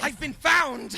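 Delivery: high, loud mock outcry; hammed-up cry of discovery; mock alarm. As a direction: cry out as if unmasked, jokingly. Mock outcry at being unmasked.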